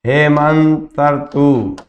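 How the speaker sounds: Hemant ऋतु drawn out very slowly, word by word; slow dictation. Shown as a demonstration. Teacher dictating Hemant ऋतु to students, very slow. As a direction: spell it out slowly for the class. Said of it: "जैसे आ गया".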